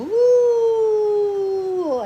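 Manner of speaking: long high loud whoop; sustained ride cheer; long woo. Real, not acted. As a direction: hold the cheer out.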